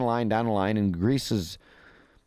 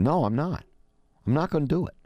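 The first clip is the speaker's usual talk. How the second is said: higher-pitched mock defiance; defiant refusal; 7 semitones higher than usual. Greece's new leader refusing the Euro bloc's demand, mock defiant. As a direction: imitate a stubborn refusal, pitched up. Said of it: "And he's going".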